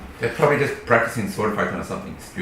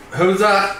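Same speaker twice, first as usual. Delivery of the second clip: gruff challenge; 9 semitones higher, 8 dB louder than usual. Acted. Hooded character challenges stranger.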